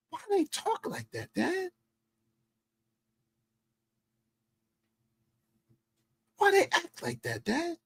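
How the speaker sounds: high, quiet questioning son voice. Retold or imitated. Son questioning his dad about the women, high soft imitation. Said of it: "my son bringing the mind to".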